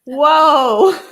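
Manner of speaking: loud rising whoa; amazed whoa. Retold or imitated. Rider hearing the horse's hooves click, loud amazed whoa. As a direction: gasp out a startled whoa. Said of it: "And I'm like".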